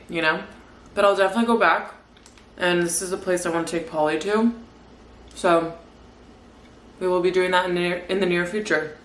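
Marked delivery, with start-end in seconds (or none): none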